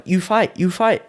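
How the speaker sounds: high-pitched imitation of an eager trainer; Thai trainer. Trainer urging a holidaymaker to fight, high mock urging. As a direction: imitate him, pitched well up. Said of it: "he just went, like".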